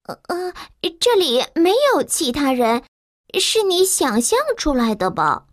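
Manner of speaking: the maid lies to the giant; nervous maid. A narrator's reading. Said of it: "佣人说". (紧张掩饰的女佣)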